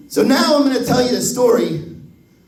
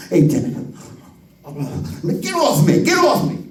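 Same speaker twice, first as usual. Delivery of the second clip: a bit whiny, "get off me" going up; teen brushing off dad. Retold or imitated. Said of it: "he's like".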